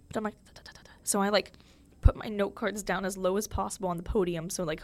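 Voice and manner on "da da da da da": barely audible voiceless "t-t-t-t" rattle; very soft rapid tongue taps, like a shaking hand; whispered stutter imitating shakes; imitated trembling. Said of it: "like, shaking".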